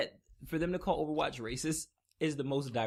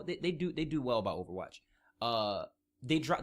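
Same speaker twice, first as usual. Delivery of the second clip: ordinary talk, nothing marked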